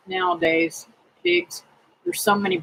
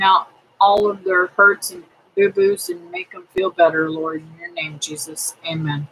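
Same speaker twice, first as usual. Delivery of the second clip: prayer; 4 semitones lower than usual. Real, not acted. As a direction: pray it gently to the Lord. Closing prayer for the viewers.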